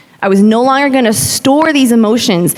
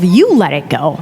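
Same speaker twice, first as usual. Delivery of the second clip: indignant retort; 3 semitones lower than usual. Retold or imitated. Student silently snapping back at yoga teacher, indignant retort. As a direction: stress on "You", pitched up then falling.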